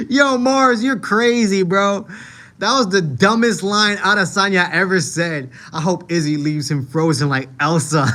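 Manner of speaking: loud, high, laugh-filled exclaiming; cracking up. Real, not acted. Streamer reacts to a viewer's joke, loud and cracking up. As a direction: shout it while fighting off real laughter.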